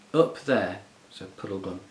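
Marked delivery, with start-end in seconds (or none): 0.1-0.8 stout. A narrator's reading.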